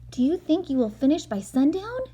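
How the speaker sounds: lightly voiced eager boy; curious little boy. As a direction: ask it like an excited kid. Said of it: "he said to Mike Mulligan".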